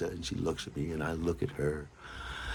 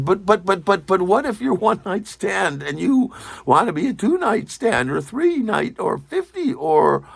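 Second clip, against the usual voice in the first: higher, louder stammering protest; flustered pleading; 10 semitones higher, 4 dB louder than usual. Retold or imitated. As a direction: plead in a rush of buts. Pleading with departing lover.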